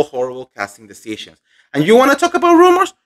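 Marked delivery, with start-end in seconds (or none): 1.7-2.9 shoots way up high and fast, scoffing; a sudden high, mocking demand; indignant challenge. Real, not acted. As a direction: fire the question back, outraged and quick. Indignant rhetorical challenge to viewers.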